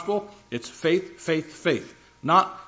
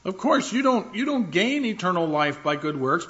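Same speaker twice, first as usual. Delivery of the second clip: mock objector; 6 semitones higher than usual. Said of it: "where they say".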